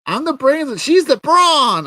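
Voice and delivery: very high, loud mocking girl voice; mock chirpy announcement, pushed high; boastful sidekick. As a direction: mimic her in a shrieky high pitch. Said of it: "she's like".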